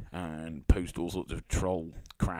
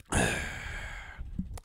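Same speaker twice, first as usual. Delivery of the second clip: long, breathy exhale; weary wordless sigh; 9 semitones lower, 5 dB quieter than usual. Real, not acted. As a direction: let out a drawn-out sigh. Reacting to the tweet he read.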